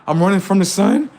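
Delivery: a frightened person answering why they run; fleeing person. Pretend. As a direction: play someone running scared.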